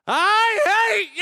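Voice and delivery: high, drawn-out mock scream; very loud, stretched-out retold shout; furious kid scream. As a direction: explode like a child in a tantrum. Child explodes at dad.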